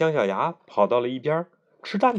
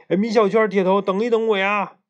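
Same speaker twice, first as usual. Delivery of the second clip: higher, louder call across a distance; 8 semitones higher, 4 dB louder than usual. Acted. Schoolboy running up calling his friends, raised call. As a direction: shout after friends to wait. Character calls from far off.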